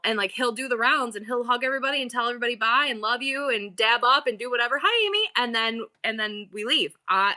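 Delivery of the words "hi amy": cheery greeting. Retold or imitated. Son saying bye to people.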